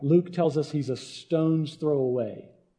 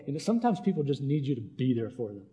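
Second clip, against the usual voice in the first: ordinary talk, nothing marked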